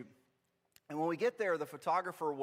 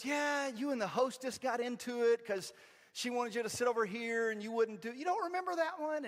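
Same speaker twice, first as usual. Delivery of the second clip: higher, animated reminiscing; eager retold dad; 8 semitones higher than usual. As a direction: imitate Dad prodding a memory. Dad eagerly recalls the story.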